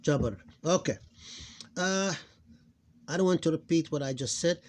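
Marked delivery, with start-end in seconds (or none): 0.0-0.4 "jabr" with no vowel after the r; sukun ending. Shown as a demonstration. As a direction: run b straight into a final r in "jabr". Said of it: "we pronounce it like this".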